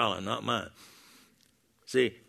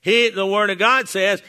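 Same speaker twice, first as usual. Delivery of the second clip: ordinary talk, nothing marked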